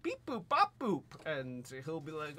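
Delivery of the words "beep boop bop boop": high robotic beep boop; chirpy little robot noises; drone beeps. Pretend. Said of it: "And the drone can be like".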